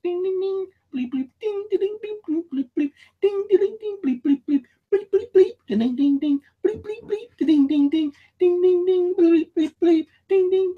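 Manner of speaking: very high squeaky ding and bleep chorus; phone dings and bleeps. Retold or imitated. Facebook and YouTube alerts mimicked in a very high squeak. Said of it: "It sounds like that".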